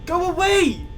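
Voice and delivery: high panicked yell at a monster; scared shout. Real, not acted. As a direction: shoo it away in panic. Animatronic at the door.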